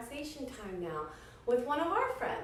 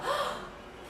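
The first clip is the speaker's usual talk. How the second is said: surprised gasp; 14 semitones higher, 6 dB quieter than usual. Acted. Mouse recognizes his friend.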